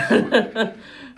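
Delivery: sudden breathy laugh; burst of laughter. Real, not acted. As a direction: laugh out loud at the joke. Reacts to South Park joke.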